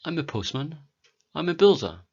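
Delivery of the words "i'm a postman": normal talking voice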